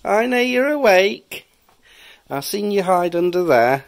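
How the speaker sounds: high, slow teasing bogeyman voice; playful spooky voice. Pretend. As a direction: creep up on a hiding child playfully. Dad teasing child awake.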